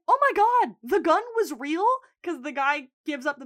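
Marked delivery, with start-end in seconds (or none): 0.1-1.8 high, loud, incredulous yelp; gasped-out disbelief, pitched way up; mock shock. Boy robber learning the gun was real, mock shock.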